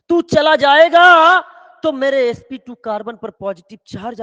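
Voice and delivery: high, loud melodramatic wail; pleading family. Acted. Family implores departing son to think of them, melodramatic pleading. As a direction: cry out and stretch the line endings. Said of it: "उन्होंने कहा".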